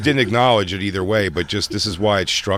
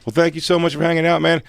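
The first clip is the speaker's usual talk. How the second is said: high upbeat DJ patter; peppy station sign-off; radio DJ voice; 6 semitones higher than usual. Retold or imitated. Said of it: "He goes".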